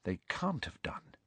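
very soft, hushed reply; quiet and restrained; low voice. A narrator's reading. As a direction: say it under your breath. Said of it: "said Hermione in a low voice".

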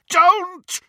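high, loud roar; bellow. A narrator's reading. Snape cutting Dumbledore off, high loud bellow. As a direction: roar it to stop him. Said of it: "bellowed Snape".

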